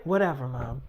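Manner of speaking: put-on low, soft teenage brush-off; dismissive. Daughter giving up on arguing with mom, retold low and dismissive. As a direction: imitate a daughter's sullen dismissal, low and quiet.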